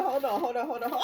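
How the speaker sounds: ordinary delivery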